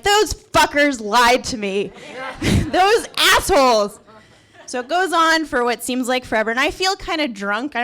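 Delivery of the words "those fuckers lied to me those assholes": loud, slow, drawn-out mock outrage; indignant. Patient waking mid-surgery about lying staff, mock indignant. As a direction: curse them slowly, hammed-up indignation.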